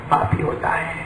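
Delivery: a preacher hushing the crowd; hushed whisper. Real, not acted. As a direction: breathe it as a whisper. Preacher drops to a hush.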